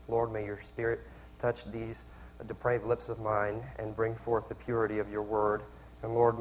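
soft, low-pitched praying voice; prayer. Real, not acted. Preacher opening the sermon in prayer, low and hushed. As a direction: pray quietly and reverently to God.

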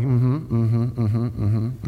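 a hint of absent nodding; lightly voiced bored churchgoer; mock distracted listener. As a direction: hum along without listening. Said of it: "look at me like".

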